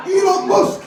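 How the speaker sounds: a little Scouser blurting out his guess; Scouse kid. Retold or imitated. Scouse kid guessing the masked singer, high-pitched. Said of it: "this Scouse kid goes".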